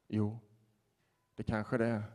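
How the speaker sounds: soft, subdued yes; a hushed, thoughtful reply; quiet admission. Retold or imitated. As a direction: concede it softly, almost to himself. Man quietly admits.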